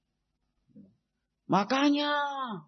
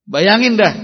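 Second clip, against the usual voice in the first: emphatic burst; 3 semitones higher, 14 dB louder than usual. Acted. Emphatic outburst.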